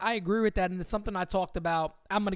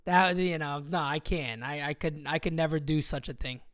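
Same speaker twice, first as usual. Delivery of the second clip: ordinary talk, nothing marked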